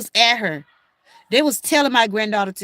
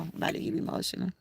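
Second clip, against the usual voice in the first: breathless fast whisper, much softer; 6 semitones lower, 14 dB quieter, much faster than usual. Real, not acted. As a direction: whisper it, fighting tears.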